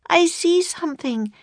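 high-pitched excited call; Annie crying out. A narrator's reading. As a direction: call out a sudden sighting. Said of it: "cried Annie".

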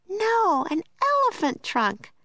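put-on very high, bright girl voice; Annie excited. A narrator's reading. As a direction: exclaim like a delighted little girl. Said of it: "said Annie".

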